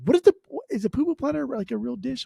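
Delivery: sudden high-pitched question; catching yourself on the weird dish name; surprised. Real, not acted. Podcast host suddenly wondering if the dish is real, surprised.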